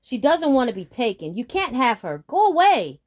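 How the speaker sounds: high, loud defiant shout. Acted. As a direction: shout him away. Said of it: "said the boy".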